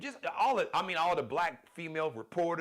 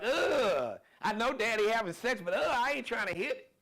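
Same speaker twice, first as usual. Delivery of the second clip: mock disgusted kid; 7 semitones higher, 4 dB louder than usual. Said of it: "your kids don't wanna be like".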